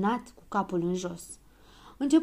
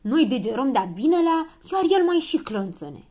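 louder, rising grumble; higher-pitched, peeved protest; grumbling complaint; 5 semitones higher, 6 dB louder than usual. A narrator's reading. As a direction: complain indignantly about someone else. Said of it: "bombănea Dondănel".

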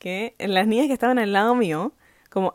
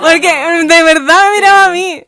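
laughing; 7 semitones higher, 12 dB louder than usual. Real, not acted. Laughing insistence.

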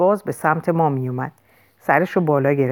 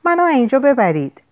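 higher-pitched, mildly pained plea; moaning; 7 semitones higher than usual. A narrator's reading. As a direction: plead with a touch of a moan. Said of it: "پسر نالید".

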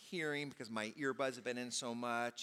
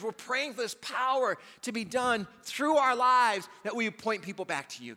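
loud, high fervent prayer; fervent preaching; 8 semitones higher, 10 dB louder than usual. Acted. Pastor praying over the congregation, loud and fervent. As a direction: preach it out with full force.

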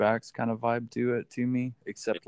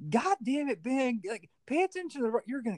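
much higher mock scolding; imitating nagging Mike; 10 semitones higher than usual. Passenger Mike scolding the driver about the road, higher mocking nag. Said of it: "I swear to God".

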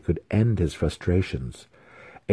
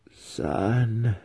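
higher ghostly question; questioning spirit; 4 semitones higher than usual. A narrator's reading. Dead mother's spirit doubts her son, ghostly questioning. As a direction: float the word up like a spirit.

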